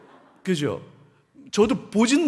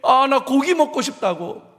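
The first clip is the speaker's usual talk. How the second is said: whining Israelite; 6 semitones higher, 6 dB louder than usual. Retold or imitated. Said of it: "이스라엘이".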